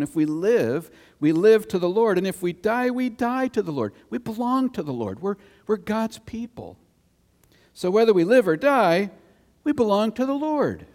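high, loud scripture reading; fervent sermon voice; preaching. Acted. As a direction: proclaim it with rising fervor. Reads Romans passage at full force.

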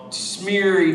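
ordinary talk, nothing marked

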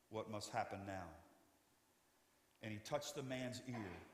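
ordinary delivery

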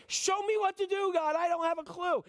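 hammed-up panicked prayer; desperate pleading. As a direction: beg urgently for direction. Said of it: "He's pleading".

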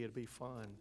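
quiet deadpan repeat; facetious. Real, not acted. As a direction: throw it away, dry. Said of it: "I say that facetiously".

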